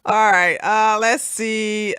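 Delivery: normal talking voice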